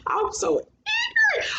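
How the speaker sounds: very high, slow, drawn-out words; high-pitched amused self-scolding; self-mocking squeal. Real, not acted. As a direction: squeal it at yourself after laughing. Self-mocking after laughing fit.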